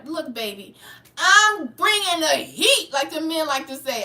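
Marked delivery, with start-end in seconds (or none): 1.2-2.9 loud, higher, drawn-out proclamation; real fired-up defiance; defiant. Real, not acted. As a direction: bring it slow and forceful. Defiant rant answering critics of her tone.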